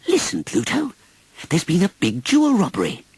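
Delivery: high, eager Mickey Mouse voice; Mickey Mouse excited. A narrator's reading. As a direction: burst out with the news. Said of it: "he said excitedly".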